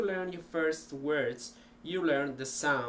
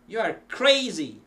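CRAZY punched high and loud, then falling; emphatic statement; 6 semitones higher, 9 dB louder than usual. Shown as a demonstration. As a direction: hit "crazy" hard as a verdict. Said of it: "hundreds of ways of pronouncing the same thing".

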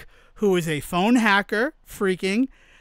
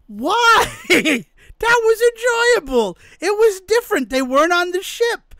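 high-pitched, incredulous defense of a favorite; hammed-up, baffled objection; indignant protest; 8 semitones higher than usual. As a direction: plead the case, voice jumping way up. Acting out protest at friend's verdict.

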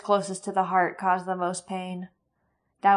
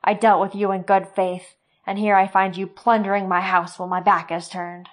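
loud, furious tirade; cursing rage; 8 dB louder than usual. Acted. Sloane cursing Roran for courting his daughter, furious. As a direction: tear into him with contempt. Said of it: "He cursed and said".

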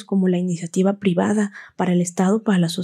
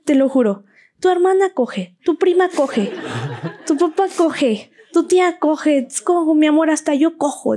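mother's voice; 7 semitones higher than usual. Retold or imitated. Mother naming whole family.

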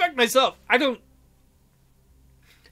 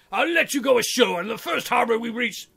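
ordinary talk

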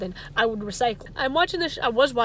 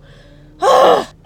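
high loud frustrated sigh; exasperated cry-sigh; 13 semitones higher, 15 dB louder than usual. Real, not acted. Exasperated at waste.

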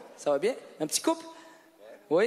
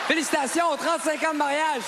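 crié plus haut et plus fort, avec enthousiasme; félicitations criées; 10 semitones higher, 4 dB louder than usual. Real, not acted. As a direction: lance tes félicitations à toute la salle. Félicite le couple marié devant la salle. (l'animateur acclame le couple marié depuis trente-cinq ans, crié avec enthousiasme)

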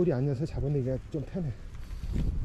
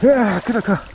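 loud thrilled yelling; excited shout; 4 semitones higher, 9 dB louder, slower than usual. Real, not acted. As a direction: shout out over a huge catch. Big catch, excited shouting.

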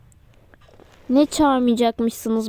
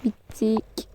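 ordinary delivery

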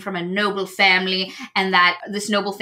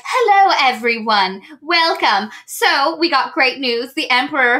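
chipper ringmaster; 4 semitones higher than usual. Retold or imitated. Circus mistress announcing to performers, chirpy hammed-up announcement. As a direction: sell bad news with a bright show voice. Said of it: "She's like".

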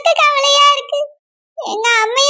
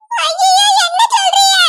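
high-pitched wail of alarm; horrified cry; 5 semitones higher than usual. Acted. Mother told her daughter fell in the well, horrified. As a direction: cry out in shock at terrible news.

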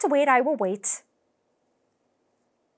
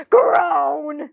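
big loud frustrated groan; 3 semitones higher, 7 dB louder than usual. Acted. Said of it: "kept getting yelled at".

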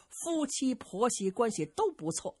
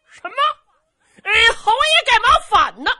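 high, loud, stunned bumpkin cry with a laugh; shocked simpleton; 14 semitones higher, 12 dB louder than usual. Acted. Zhu Can hears the marquis rebelled, shocked cry. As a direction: blurt it out in disbelief.

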